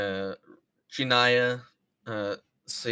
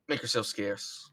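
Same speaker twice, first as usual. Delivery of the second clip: ordinary delivery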